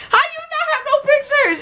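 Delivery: high laughing shout of disbelief. Retold or imitated. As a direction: call it out, cracking up. Friends pressing about photos.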